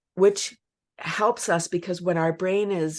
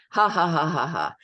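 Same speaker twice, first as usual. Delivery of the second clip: full-on giggles demo; 3 dB louder than usual. Shown as a demonstration. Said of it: "like, full-on giggles".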